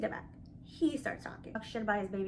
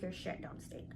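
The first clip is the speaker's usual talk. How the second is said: whispered punchline, softer; 4 semitones lower, 13 dB quieter than usual. Real, not acted. Whispering the jab about arrogant men, whispered.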